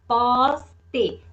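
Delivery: "poste" as pos, pause, te; slow syllable-by-syllable "poste"; split into syllables. Shown as a demonstration. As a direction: sound out "poste" in two distinct beats. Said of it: "eu separei".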